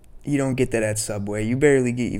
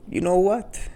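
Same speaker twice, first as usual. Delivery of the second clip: much higher mock old-man lean-in question; imitating old Italian deli man; 11 semitones higher, 4 dB louder than usual. Old deli owner leaning close to a customer, mock imitation. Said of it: "he'll go".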